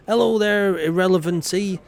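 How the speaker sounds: a big hearty hello to a newcomer; loud greeting. Acted. Greeting a new viewer loudly.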